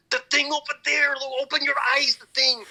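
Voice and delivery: loud, high exasperated dad; imitated crazed Mexican father. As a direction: yell it like a hammed-up Mexican father losing it. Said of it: "his father getting, like, all crazy".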